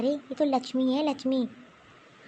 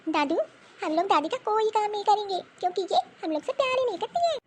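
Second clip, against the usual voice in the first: put-on higher child voice, sulky complaint; little girl voice; 8 semitones higher than usual. Granddaughter complaining to grandpa about grandma, high and sulky. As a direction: pitch up like a pouting little girl.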